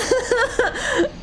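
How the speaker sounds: high wailing laugh. Real, not acted. Laughing at his defeat.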